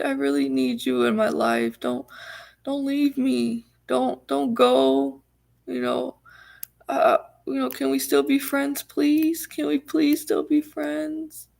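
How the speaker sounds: theatrical begging, words stretched out; desperate begging. Retold or imitated. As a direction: imitate a clingy friend pleading. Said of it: "like, begging".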